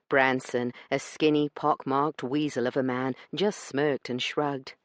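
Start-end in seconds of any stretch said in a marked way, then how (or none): none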